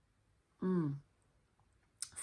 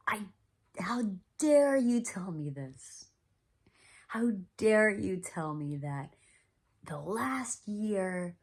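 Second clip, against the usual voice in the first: loud, raised, slow indignant retelling, running into blahs; angry retort; 5 semitones higher, 7 dB louder, slower than usual. Retold or imitated. Triggered patient answering back to mentor, angry. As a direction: reenact an outraged telling-off, punching each phrase. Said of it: "I was angry".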